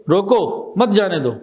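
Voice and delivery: slow demo, روکو, then مت جانے دو together; pause after روکو. Shown as a demonstration. Teacher asking what it means, stopping after روکو before مت جانے دو. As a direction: put the stop right after روکو, before مت. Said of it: "روکو پہ اوپر".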